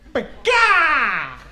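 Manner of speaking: high, loud mock bird cry; bird screech. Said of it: "I don't know why that sounded feminine".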